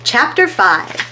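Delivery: ordinary delivery, nothing marked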